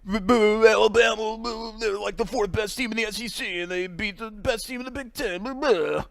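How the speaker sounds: high, blustering caricature with stammering splutters; mock SEC fan. Podcast host mocking SEC people coming out of the woodwork, high spluttering caricature. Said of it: "those people to come back out of the woodwork".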